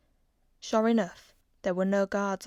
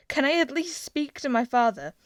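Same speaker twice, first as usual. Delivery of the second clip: higher, louder pleading question; 6 semitones higher, 7 dB louder than usual. A narrator's reading. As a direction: beg the guards for his father. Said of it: "he said".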